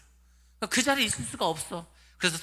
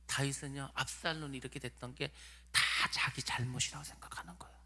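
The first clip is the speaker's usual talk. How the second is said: softer, drawn-in whisper of a secret; hushed; 4 semitones lower, 9 dB quieter than usual. Real, not acted. Preacher whispering to the congregation that David blamed himself. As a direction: lean in and whisper it. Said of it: "hushed confession of David's guilt".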